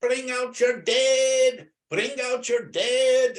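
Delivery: slow, high, loud drawn-out cry; hammed-up Monty Python call; screaming plague cart man. Teacher retelling the skit to students, slow high mock town crier call. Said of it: "and he's screaming".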